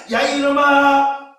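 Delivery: loud, drawn-out pulpit shout; shouted rebuke. Acted. Preacher telling off the devil, shouted rebuke. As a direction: bellow it slowly at the tempter.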